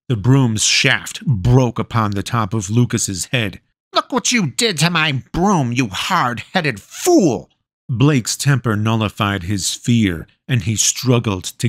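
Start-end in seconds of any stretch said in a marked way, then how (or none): 3.9-7.5 high, slowing crone scold; scolding witch. A narrator's reading. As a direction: spit the insult at the end.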